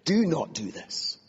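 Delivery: high, slow, enraged command; through clenched teeth. Acted. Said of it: "Through clenched teeth, I say".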